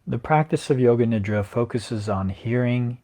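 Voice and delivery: even script reading with long pauses; guided meditation. Acted. Yoga teacher reading Yoga Nidra to resting listeners, guided meditation. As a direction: guide listeners unhurriedly, leaving long silences between phrases.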